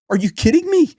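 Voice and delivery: high, disbelieving outburst; incredulous. Real, not acted. Outraged at praise for Putin.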